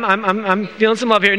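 normal talking voice

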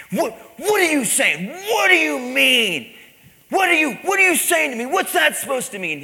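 high, very loud outraged yelling; furious shout. Acted. Husband screaming back at the pastor's verse, furious. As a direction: explode in baffled rage. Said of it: "was violent and screaming".

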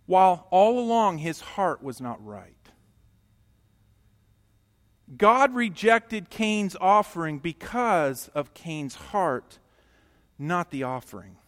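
loud, driving sermon delivery; full-force preaching. Acted. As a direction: preach it hard to the congregation.

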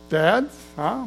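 ordinary delivery

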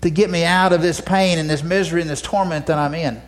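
high, pleading acted cry to God; crying out for mercy. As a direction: beg God for anything at all. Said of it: "he's crying out for mercy".

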